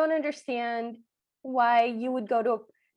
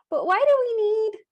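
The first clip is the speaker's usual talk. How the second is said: high, louder puzzled question; questioning Cinderella; 7 semitones higher, 5 dB louder than usual. Retold or imitated. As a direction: pipe up confused like Cinderella. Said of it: "and Cinderella goes".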